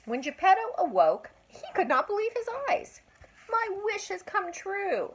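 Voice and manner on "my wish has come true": put-on high, joyful shout of an old man. A narrator's reading. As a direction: cry it out in wonder. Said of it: "he shouted".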